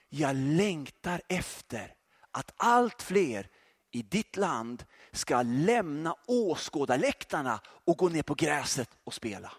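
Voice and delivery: slow, hushed voice of the Spirit; soft whisper. Acted. The Holy Spirit speaking to the preacher, slow hushed whisper. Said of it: "en helig ande viskade till mig".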